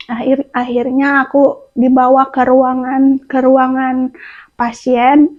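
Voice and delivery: ordinary talk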